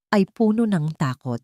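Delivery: ordinary talk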